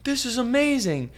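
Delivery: high amazed gasp of praise; awed exclaim. Retold or imitated. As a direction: gush it like you're stunned.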